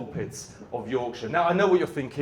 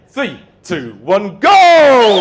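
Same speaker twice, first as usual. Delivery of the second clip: high, loud starting yell; rousing cue shout; 4 semitones higher, 15 dB louder, much slower than usual. Pretend. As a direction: set the crowd off with a big shout. Cue for crowd to go crazy.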